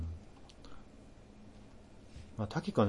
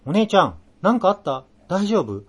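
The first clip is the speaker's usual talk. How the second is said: worried younger sister; 8 semitones higher, 6 dB louder than usual. Retold or imitated. Sister's worried message voiced.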